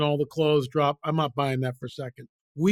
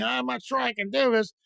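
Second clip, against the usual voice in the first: mock worried, higher-pitched voice; hammed-up self-doubt, higher voice; unsure of himself; 7 semitones higher than usual. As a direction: play a doubter fretting, pitched up. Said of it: "He's not going".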